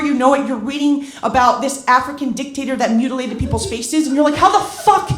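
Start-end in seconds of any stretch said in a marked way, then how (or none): 4.4-5.1 high, loud, incredulous outcry; exasperated disbelief. Acted. Reader lost in a Wikipedia vortex, exasperated disbelief. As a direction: cry out, utterly baffled at where you are. Acted bewilderment after clicking into vortex.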